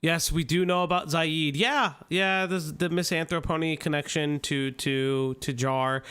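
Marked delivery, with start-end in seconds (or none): none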